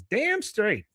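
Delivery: high-pitched character reply; retold firm agreement. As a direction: agree emphatically in a put-on high voice. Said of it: "He goes".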